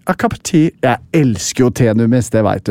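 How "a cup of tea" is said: posh British accent. Shown as a demonstration. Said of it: "litt sånn Oxford English, proper".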